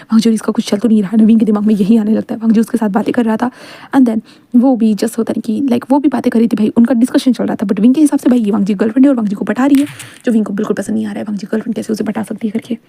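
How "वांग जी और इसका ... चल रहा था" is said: ordinary delivery, nothing marked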